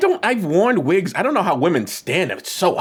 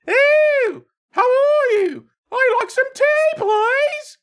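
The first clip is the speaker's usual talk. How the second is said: mock British accent. Host mocks a British accent in falsetto. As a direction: send up a Brit in falsetto. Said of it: "accurate British accent".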